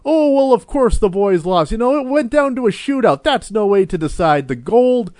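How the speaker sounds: high, earnest excuse-making voice; mock heritage narrator. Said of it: "that was a hilarious one".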